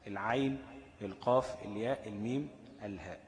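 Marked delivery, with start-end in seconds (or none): none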